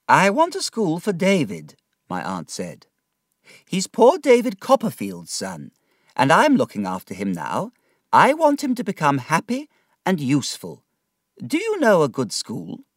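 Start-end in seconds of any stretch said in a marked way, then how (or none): 0.1-1.8 put-on higher, decided old aunt voice; brisk aunt. Aunt deciding David's schooling, brisk. 3.7-12.8 put-on higher, decided old aunt voice; brisk aunt. Aunt deciding David's schooling, brisk.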